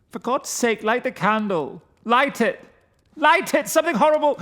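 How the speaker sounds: high, loud terrified cries; panicked pleading. Acted. Frightened friend begging Winston in the dark, panicked. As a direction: beg for the light in rising terror. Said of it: "Then his voice broke".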